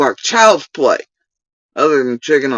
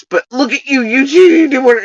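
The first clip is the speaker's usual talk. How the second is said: mocking quote; 10 semitones higher, 4 dB louder than usual. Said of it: "And then it's".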